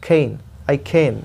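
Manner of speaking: can said as खेन, between k and kh; slow खेन, half-aspirated k; khen demo. Shown as a demonstration. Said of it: "क और ख के बीच वाला होता है".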